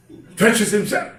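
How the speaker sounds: normal talking voice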